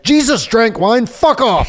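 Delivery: high, drawn-out indignant comeback; angry retort. Retold or imitated. Man retelling his row with a street preacher, angry. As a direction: act out snapping back at a heckler.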